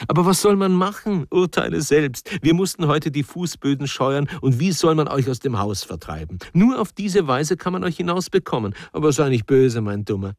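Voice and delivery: high-pitched, cajoling wife's voice; teasing wife. A narrator's reading. Wife explains her trick to her husband, teasing and cajoling. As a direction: coax him playfully, pitched well up.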